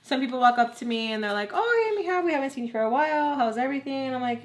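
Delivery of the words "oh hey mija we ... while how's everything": gushing greeter. Retold or imitated. Acquaintances greeting her at a party, mock gushing hello. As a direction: imitate a chatty acquaintance, pitched up.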